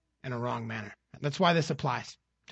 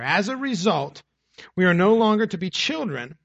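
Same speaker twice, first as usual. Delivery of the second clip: scripture reading; 5 dB louder than usual. Acted. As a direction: read the passage to the congregation with weight. Reads scripture from the pulpit.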